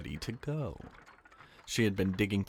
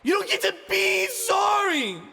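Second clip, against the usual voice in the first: very high, loud screamed rebuke; enraged shouting girl; furious scream; 10 dB louder than usual. Acted. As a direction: scream it down at him. Ani screaming at Ivan.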